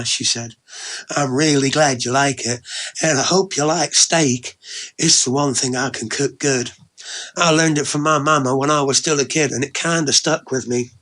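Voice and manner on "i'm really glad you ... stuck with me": lightly voiced Southern woman, slightly lifted; Ellie Mae, pleased and chatty. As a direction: chat fondly with a touch of Southern twang. Ellie Mae's dialogue.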